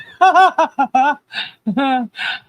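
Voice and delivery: high, rolling laughing fit; helpless laughter. Real, not acted. Recalling their silly game, helpless high laughter. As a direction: laugh until it runs out. Finds the memory so funny.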